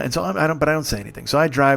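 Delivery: ordinary talk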